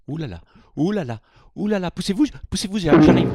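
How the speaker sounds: loud, fast, frantic calling out to clear the way; panicked dash, shouting people aside; mock rushing shout. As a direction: hurry through an imaginary crowd, yelling to get past. Rushing through crowd, pushing past.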